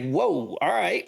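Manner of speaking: high, stunned whoa, all right; surprised whoa. Retold or imitated. To the man who was the sergeant major, surprised and high. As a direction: pitch it up like it just hit you.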